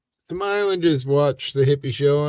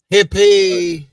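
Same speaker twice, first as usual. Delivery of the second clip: loud call; 4 semitones higher, 8 dB louder than usual. Real, not acted. Calling out host's name.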